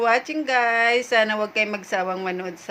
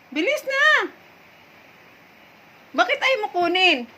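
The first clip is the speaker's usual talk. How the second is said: higher, louder sing-song urging; coaxing the dog; 6 semitones higher, 5 dB louder than usual. Real, not acted. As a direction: cheer a stubborn pet on. Coaxing dog to take ball.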